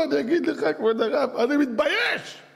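high-pitched, acted crying plea; hammed-up ashamed wail; tearful confession. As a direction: plead with the rabbi as if crying. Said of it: "והוא בוכה".

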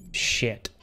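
ordinary delivery

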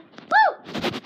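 high excited woo. Real, not acted. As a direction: squeal out a happy woo. Cheering at the toy.

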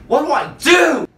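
high, loud pleading cry; hammed-up cry for help; mock desperate shout. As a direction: shout it up at the ceiling. Desperate plea to viewers.

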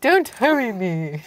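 put-on British accent, slowing at the end; British king. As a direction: act a kingly British voice. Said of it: "That sounds like a king".

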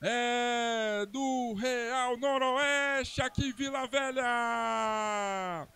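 very slow, long stretched vowels; drawn-out goal call. Real, not acted. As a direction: stretch every word after the goal. Announcing the goal scorer team.

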